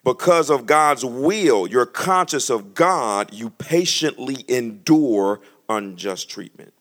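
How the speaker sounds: slow deliberate reread. Shown as a demonstration. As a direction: read it again slowly for them. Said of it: "I'm gonna read that again".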